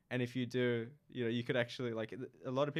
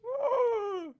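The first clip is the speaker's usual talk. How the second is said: high, delighted cheer; excited whoop; 6 dB louder than usual. Real, not acted. Guest promised a Tim Tam, excited high whoop. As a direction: whoop with glee at the treat.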